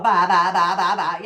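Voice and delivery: laughing blah-blah; mocking blah-blah. Mocking her husband's weight-loss comments, laughing. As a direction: mimic his chatter with a laugh. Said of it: "all those comments about his weight".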